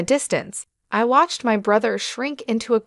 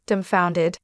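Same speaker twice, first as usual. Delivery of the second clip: ordinary delivery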